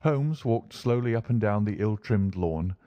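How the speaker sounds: ordinary delivery, nothing marked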